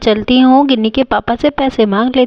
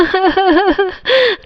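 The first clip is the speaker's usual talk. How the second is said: high staccato fake sobs; mock sobbing; 8 semitones higher than usual. Scolded wife crying over a saree, acted sobs. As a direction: sob in theatrical bursts. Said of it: "mummy कितना ज़्यादा रो रही है".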